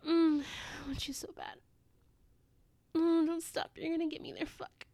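moaning, sighing delivery, swelling on the exclamations; breathy arousal. Acted. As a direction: gasp and moan between breathy phrases. Acted intimate scene, aroused.

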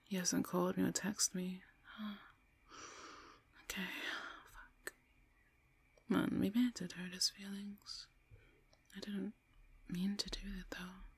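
soft, whispery thinking aloud; regretful murmur. Acted. Woman alone worrying about her boyfriend, regretful murmur. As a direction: murmur with a touch of guilt.

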